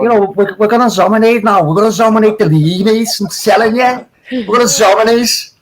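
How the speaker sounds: high gloating Scouse fan voice; Scouse accent. Retold or imitated. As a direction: boast it in a broad Scouse accent. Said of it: "I'll do it in a Scouse accent for you".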